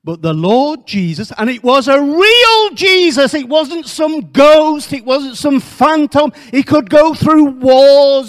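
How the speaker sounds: high, loud, building sermon; fervent preaching. Acted. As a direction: preach it with rising fire. Preaches at full force.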